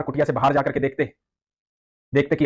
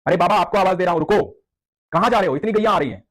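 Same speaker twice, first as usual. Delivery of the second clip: put-on higher child's voice calling out; calling boy; 5 semitones higher than usual. Cowherd boy calling to the angry devotee, high and urgent. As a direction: call after the old man like a village boy. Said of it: "बालक ने फिर आवाज दी".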